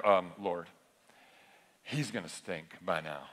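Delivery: low, soft mock Martha, half laughing; deadpan Martha. Preacher voices Martha dropping a blunt fact. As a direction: play Martha stating the obvious, drily. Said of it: "And Martha's like".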